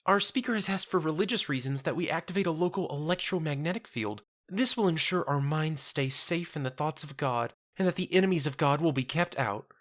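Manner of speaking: put-on a kindly young church sister soothing a stranger; gentle churchwoman. Marifold consoling Jenna in a high gentle voice.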